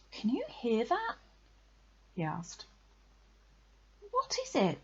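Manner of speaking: put-on high-pitched, curious child; intrigued question. A narrator's reading. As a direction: ask with sudden alert interest. Hears a distant sound, asks.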